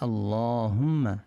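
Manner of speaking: আল্লাহুম্মা with the long drawn vowel, slow. Shown as a demonstration. Teacher shows the hyphen's long vowel in আল্লাহুম্মা. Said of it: "বাংলাটা খেয়াল করি".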